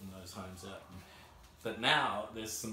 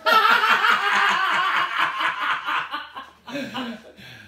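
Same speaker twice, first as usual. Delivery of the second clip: loud, high, long cackle; real helpless peal of laughter; big laugh; 16 dB louder than usual. Real, not acted. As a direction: burst out laughing and keep going. Laughs about getting a refund.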